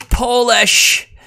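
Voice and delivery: very high, loud panicked shout; alarmed cry. Real, not acted. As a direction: panic at the migrants.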